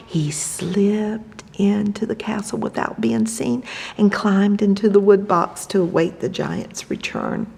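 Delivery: very soft whisper. A narrator's reading. Telling children how Jack hides unseen in a whisper. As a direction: hush the words, sneaking.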